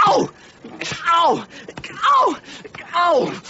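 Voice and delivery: sharp yelps rising to high shouted cries; pained yelps. Acted. Devil yelping as the smith beats him, sharp pained yelps. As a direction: cry out in pain with each blow.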